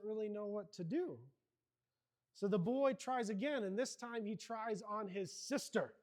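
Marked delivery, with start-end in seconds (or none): none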